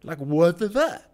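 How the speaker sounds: fed-up vlogger swearing at the crowds; exasperated. Real, not acted. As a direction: throw up your hands in disbelief. Ranting about crowded lockdown streets.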